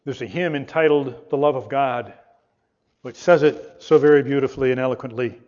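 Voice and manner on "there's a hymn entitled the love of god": ordinary delivery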